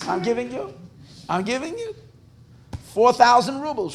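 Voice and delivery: high voice, halting before the figure; mock-desperate vow. Merchant pledges rubles to Hashem, mock-desperate vow. Desperate man raises offer to God.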